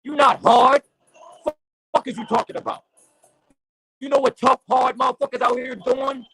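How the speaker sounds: impassioned street preacher rant; fired-up preaching. Real, not acted. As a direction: rail at the listeners like a pulpit sermon.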